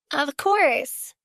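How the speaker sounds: strained, fake-pleasant reply; forced smile. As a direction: smile through clenched politeness. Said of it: "Naomi forced a smile".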